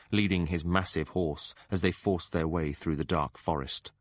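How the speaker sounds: plain reading voice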